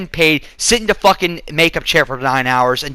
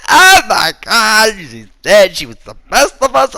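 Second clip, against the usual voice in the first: mock grief; 5 semitones higher, 5 dB louder than usual. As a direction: overdo the fans' dramatic sorrow. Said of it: "Everyone's like".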